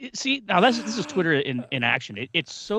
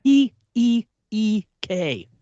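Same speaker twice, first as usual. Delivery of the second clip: "E E E K" spelt out slowly; slow spelled "E E E K"; eek; 7 semitones higher, 7 dB louder, much slower than usual. Shown as a demonstration. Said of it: "you spelled it the other wrong way".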